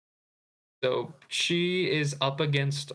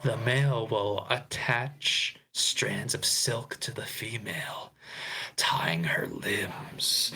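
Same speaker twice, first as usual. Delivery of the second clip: Discord mod voice; slower than usual. Acted. Host reading Peter's spider courtship lines, whispered Discord mod voice. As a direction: read it slowly in a hushed, creepy whisper. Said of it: "I'm gonna do this in my Discord mod voice".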